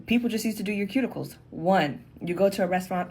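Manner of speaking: ordinary talk, nothing marked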